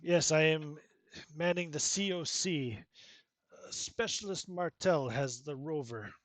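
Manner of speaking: lightly voiced Ukrainian cosmonaut, remorseful; Ukrainian accent. Cosmonaut confesses firing on commander, a hint of Ukrainian accent. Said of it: "He says".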